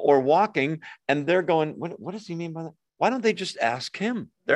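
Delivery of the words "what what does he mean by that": quick, hushed confused mutter; puzzled mutter. Retold or imitated. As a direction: mutter it fast under your breath, baffled. Puzzled disciples asking each other.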